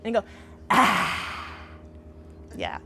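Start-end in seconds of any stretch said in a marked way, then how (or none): 0.7-1.2 tongue-out breathy ah, a little louder; lion's breath. Shown as a demonstration. Teacher demonstrating lion's breath for the class, tongue-out ah. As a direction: exhale hard with your tongue out. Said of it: "stick out your tongue like this".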